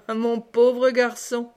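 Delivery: slow, scornful mock pity; mocking disdain. A narrator's reading. Mother greets her vexed son, slow mocking disdain. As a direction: draw it out with amused disdain. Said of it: "avec des rires de dédain".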